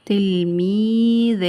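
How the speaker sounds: تلميذان stretched very slowly on level pitch; drawn-out repeat. Shown as a demonstration. Teacher repeating a word for pupils, drawn out. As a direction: hold every long vowel in تلميذان.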